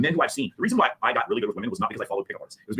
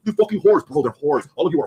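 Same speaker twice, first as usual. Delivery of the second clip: loud, raised-pitch mock rant; higher, louder, hammed-up abuse; imitated name-calling; 4 semitones higher, 5 dB louder, slower than usual. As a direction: imitate a man hurling insults at a crowd. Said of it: "he'll call the".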